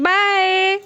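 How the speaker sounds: high, loud drawn-out farewell; calling out goodbye. Real, not acted. (बच्चे को ऊँची आवाज़ में पुकारते हुए विदा)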